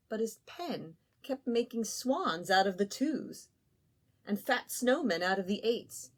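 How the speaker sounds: ordinary delivery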